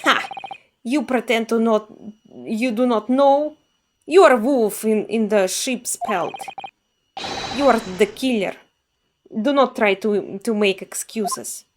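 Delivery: put-on a guard scolding in a light Russian accent. Guard scolds the sleeping prisoner, light Russian accent. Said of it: "I assume he's Russian".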